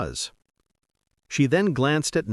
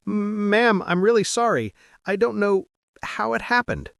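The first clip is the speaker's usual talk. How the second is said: flustered stutter; 8 semitones higher than usual. A narrator's reading.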